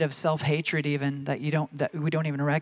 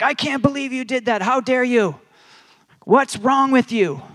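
higher and louder, outraged rebuke; angry rebuke; 8 semitones higher, 8 dB louder than usual. Retold or imitated. God as we imagine him, rebuking our mistake. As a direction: let the outrage boil over.

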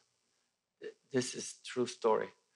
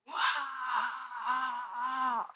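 ecstatic shout; 13 semitones higher than usual. Retold or imitated. Imitating frenzied fans at a rock show, ecstatic shout. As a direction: let out a wild fan's whoop. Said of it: "fans were just freaking out".

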